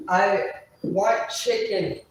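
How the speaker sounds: loud, very slow, every word pounded; emphatic shout. Real, not acted. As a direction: hammer the order home, no give. Insisting on his chicken order.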